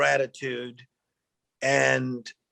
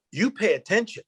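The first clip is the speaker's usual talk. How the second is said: emphatic praise; 7 semitones higher than usual. Retold or imitated. Quotes older mentor praising him.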